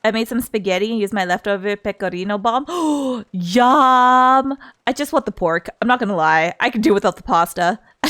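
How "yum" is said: ordinary talk, nothing marked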